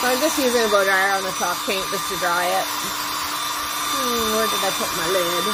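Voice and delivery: talking loudly over dryer noise; over hair dryer. Real, not acted. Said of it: "so please watch your ears".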